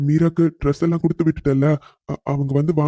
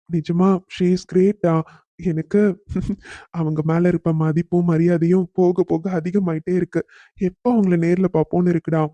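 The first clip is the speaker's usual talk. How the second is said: ordinary talk, nothing marked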